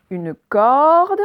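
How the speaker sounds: "corde" with a long, loud open /ɔ/. Shown as a demonstration. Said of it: "cette fois c'est bien le O ouvert". (« corde » avec un O ouvert appuyé)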